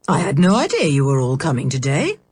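lightly voiced absent-minded uncle; Uncle Quentin voice. A narrator's reading.

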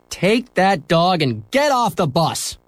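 slow, high commanding bus driver; stern order. Acted. Bus driver ordering Henry off the bus, slow and stern. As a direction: order the boy off, deliberately. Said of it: "he ordered".

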